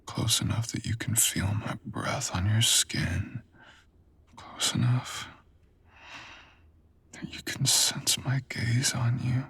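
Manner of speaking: whispered, leaning close in; intimate whisper. Acted. Seducer whispering to the listener beside the altar, close whisper. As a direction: whisper it right against their skin.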